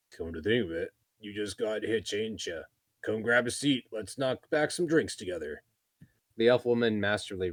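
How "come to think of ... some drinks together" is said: loud, high coarse regular; rough patron voice. Acted. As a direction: tease like a pub regular.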